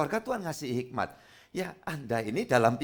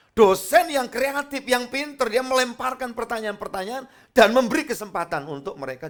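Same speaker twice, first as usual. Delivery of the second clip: preaching; 9 semitones higher, 5 dB louder than usual. Acted. Preaching with rising force.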